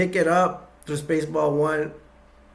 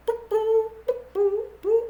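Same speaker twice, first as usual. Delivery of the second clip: high little boop boops; beeping noise. Retold or imitated. Ship makes these noises, high mock beeps. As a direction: imitate the machine's beeps, high. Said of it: "it makes these noises".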